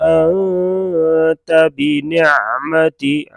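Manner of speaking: बिनिअमति with the ain from the throat, slow; slow demo of बिनिअमति. Shown as a demonstration. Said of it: "आइन गले से अदा करेंगे".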